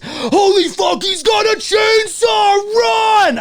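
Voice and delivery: high, slow, loud terrified shouting; panicked yell. Retold or imitated. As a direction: scream like teens fleeing a chainsaw. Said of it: "All I heard ... was".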